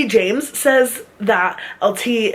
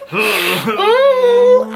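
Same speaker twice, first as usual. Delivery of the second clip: a squealing laughing oh, well above her usual pitch; laughing high oh. Retold or imitated. Friend reacting to the bad photo, laughing high.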